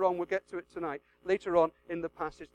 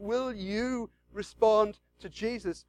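usual speaking voice

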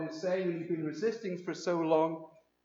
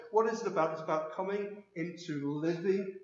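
ordinary talk, nothing marked

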